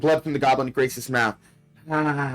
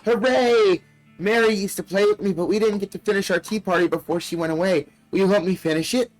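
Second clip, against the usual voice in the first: talking doll; 6 semitones higher than usual. Acted. Doll speaks in story.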